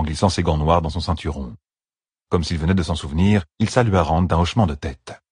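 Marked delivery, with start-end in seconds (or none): none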